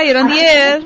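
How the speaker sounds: ordinary talk, nothing marked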